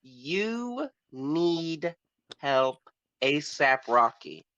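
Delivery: ordinary delivery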